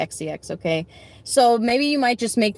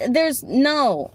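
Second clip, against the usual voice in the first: ordinary talk, nothing marked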